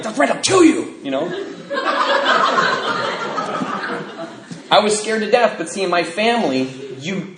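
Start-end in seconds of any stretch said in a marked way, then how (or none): 0.0-0.9 high, laughing fake-menace; mock tough threat. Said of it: "I'm like". 1.3-4.6 breathless squeal of a laugh; high airy giggle; high wheezing laugh. Real, not acted. Laugh after the retold threat.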